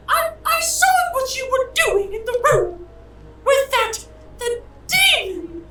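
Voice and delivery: very high falsetto wife, accusing; shrill-pitched angry wife. Acted.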